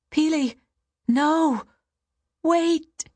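high, desperate calling out; anxious cries. A narrator's reading. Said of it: "he cried".

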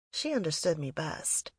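ordinary delivery, nothing marked

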